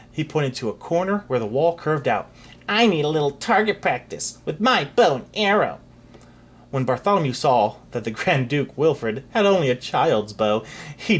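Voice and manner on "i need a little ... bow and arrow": put-on bratty boy voice. Wilfred taunts.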